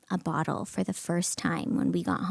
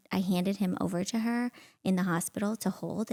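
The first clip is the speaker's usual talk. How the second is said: ordinary talk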